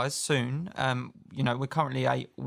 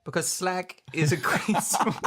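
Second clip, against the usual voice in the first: slow American accent breaking into laughter; bad American accent; 6 semitones higher, slower than usual. Shown as a demonstration. Co-host giving his reason on a dare, bad American accent. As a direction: overdo the American accent until you crack up. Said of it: "really bad accent that I'm about to give".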